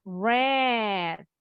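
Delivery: "read" pronounced RED, short e; past-tense read. Shown as a demonstration. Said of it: "tapi bacanya yang beda". ("read" dibaca red, seperti warna merah)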